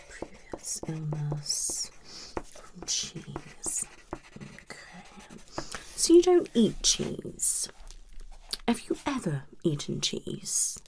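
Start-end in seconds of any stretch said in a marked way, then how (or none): 0.1-5.4 soft, slow self-talk; note-taking murmur. Acted. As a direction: read it out quietly as you write. Therapist noting down answers aloud.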